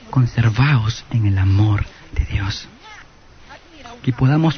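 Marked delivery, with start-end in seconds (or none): 0.1-2.7 slow, low whisper of the verse; slow hushed whisper, lower; whispered scripture. Acted. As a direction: breathe the exhortation slowly, like a secret. Reading the scripture exhortation.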